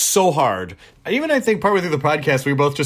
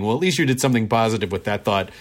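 ordinary talk

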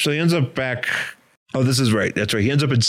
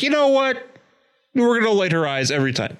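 much higher, fast excited director voice; mock filmmaker; 10 semitones higher than usual. Filmmaker deciding to light her eyes, high fast mock. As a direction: blurt the decision in a high, quick mock voice. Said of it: "That is just like".

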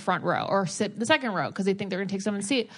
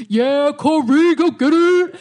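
loud, higher, slow bro whoop; rowdy frat cheer; 6 semitones higher, 10 dB louder than usual. Retold or imitated. Drunk guests cheering Corey at his wedding, loud bro whoop. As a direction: bellow it like a drunk groomsman at a ballgame.

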